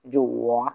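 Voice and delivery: "জো ওয়া" dipping down, then rising; teacher's dip-and-rise tone on "জো ওয়া"; third tone. Shown as a demonstration. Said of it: "উপর থেকে নিচে".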